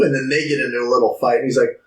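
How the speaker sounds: usual speaking voice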